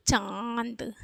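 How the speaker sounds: चांद with very long nasal आ, soft released द; nasalised vowel demo. Shown as a demonstration. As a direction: stretch चांद through mouth and nose. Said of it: "इसका उच्चारण कैसे करेंगे?".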